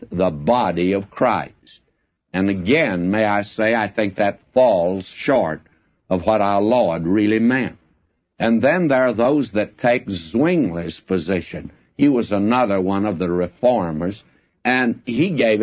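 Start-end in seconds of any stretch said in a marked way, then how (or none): none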